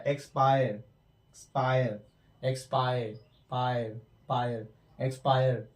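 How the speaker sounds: एक्सपायर repeated slowly, stretching the 'आयर' ending; drilled word. Shown as a demonstration. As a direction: model एक्सपायर syllable by syllable. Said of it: "आई की तरह आ रहा है". (एक्सपायर को धीरे-धीरे दोहराना)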